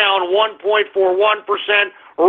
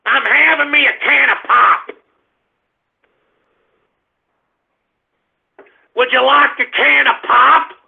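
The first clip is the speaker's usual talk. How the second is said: exaggerated up-north US accent, pitched higher; mock northern accent; 4 semitones higher than usual. Host mocks northerners offering pop, exaggerated northern accent. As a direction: imitate northerners with a hammed-up accent. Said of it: "what do you idiots out there up north say?".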